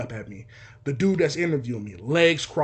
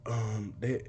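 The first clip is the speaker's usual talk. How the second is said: low, very soft, hesitant; timid mumble; 5 semitones lower, 9 dB quieter than usual. Retold or imitated. Son on phone asking dad for food, timid mumble. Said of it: "speak up".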